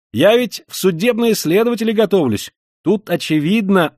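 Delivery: high, loud, slowing on the repeat; heated exclaim. A narrator's reading. Young man heatedly insists something is wrong, to Koch. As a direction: press the point hotly. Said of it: "горячо вскричал молодой человек".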